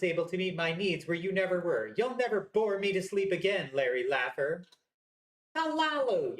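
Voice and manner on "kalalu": Kalalu cried out much higher; hammed-up anguished cry of the name. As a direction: wail her name in dismay, pitched up. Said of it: "you cry".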